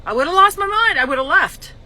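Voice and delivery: high, loud exasperation; exasperated. Retold or imitated. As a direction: vent that you'd have snapped. Retelling how she'd react.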